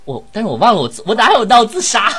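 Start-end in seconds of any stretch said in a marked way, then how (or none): 1.1-2.1 high, loud indignant yelp; indignant protest. Real, not acted. Actor denies staging a suicide. (高声委屈地抗议)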